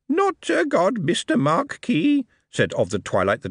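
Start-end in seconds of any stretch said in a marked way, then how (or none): 0.1-2.3 high, cheeky goblin voice; a brash little goblin charming the host. Acted. Goblin speaks.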